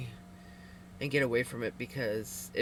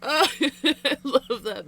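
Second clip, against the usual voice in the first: high, loud, laughing reaction; real amused giggling; delighted laughing; 10 semitones higher, 7 dB louder than usual. Real, not acted. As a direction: laugh your way through the words. Reacting to a listener's joke.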